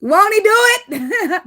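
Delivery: high, loud, slow laughing exclaim; joyful laughing church shout; laughing praise. Real, not acted. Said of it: "That's the energy".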